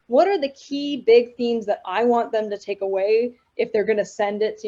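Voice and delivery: usual speaking voice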